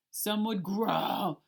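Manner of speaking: slow growling monster voice; growly. A narrator's reading. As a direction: growl it out slowly like a beast. Narrator growls the word growl.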